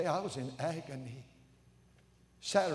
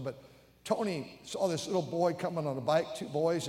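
ordinary talk

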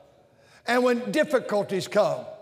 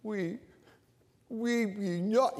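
very soft, slow whining; whine; 7 dB quieter, slower than usual. Shown as a demonstration. Preacher demonstrating the whine to the church, soft. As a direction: pout it out, whiny. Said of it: "to a little whine here".

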